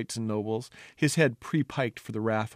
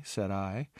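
ordinary delivery, nothing marked

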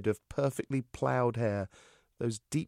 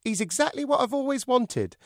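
high, louder puppet voice; ventriloquist dummy voice; 14 semitones higher, 5 dB louder than usual. Acted. Dummy thanks the hostess before her guests, high and bright. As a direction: pipe it up like a cheeky wooden dummy. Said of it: "I said".

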